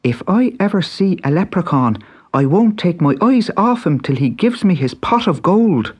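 put-on high, eager young Irish boy; bright boyish Irish lad; Pat's boy voice. A narrator's reading. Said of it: "he was always saying to himself".

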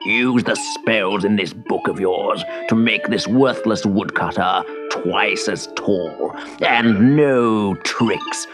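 villainous baron, low and threatening; menacing snarl. A narrator's reading. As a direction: snarl the orders like a tyrant. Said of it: "snarled the Baron".

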